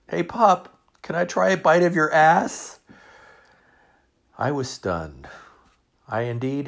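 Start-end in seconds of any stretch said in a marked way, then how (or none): 0.1-2.8 put-on high, loud teasing kid voice; giggling son. Son teases dad about his plate, high and giggly. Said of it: "My son just giggled".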